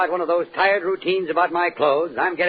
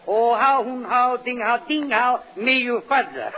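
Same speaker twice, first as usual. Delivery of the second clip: mock Chinese reply; 4 semitones higher, slower than usual. Answers caller in mock Chinese.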